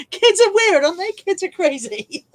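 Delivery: high, amused exclamation with laughter; laughing amazement. Real, not acted. Marvelling at odd childhood playground rules, laughing.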